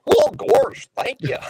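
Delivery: high cartoon voice, fading to a breathy giggle; Goofy voice. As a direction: squeak it out like a goofy cartoon dog. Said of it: "I'm gonna do my Goofy voice".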